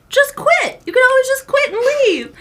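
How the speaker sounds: high-pitched mock dad urging; exaggerated, emphatic parental urging; imitating dad's advice. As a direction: push simple advice in a high voice.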